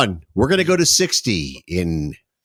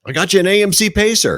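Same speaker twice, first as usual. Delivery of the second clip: higher, louder, excited father voice; giddy dad; 12 semitones higher, 4 dB louder than usual. Retold or imitated. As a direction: speel de uitgelaten vader.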